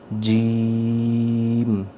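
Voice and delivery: جیم with the vowel held very long; lengthened jeem. Shown as a demonstration. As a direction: stretch the middle of جیم. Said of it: "اس پہ ہم نے مد کرنی ہے". (جیم مد کے ساتھ لمبا)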